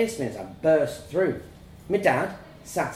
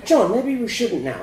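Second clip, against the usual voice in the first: high, louder motherly plea; protesting mum; 8 semitones higher, 5 dB louder than usual. A narrator's reading. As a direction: cut in to stop him. Mum interrupts to dissuade dad.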